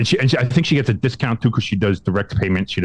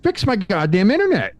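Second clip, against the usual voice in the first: high, laughing retort; high exasperated retort with a laugh; laughing exasperation; 10 semitones higher than usual. Retold or imitated. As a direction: laugh through the frustrated demand. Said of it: "I was like".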